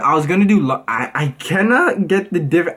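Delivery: ordinary talk, nothing marked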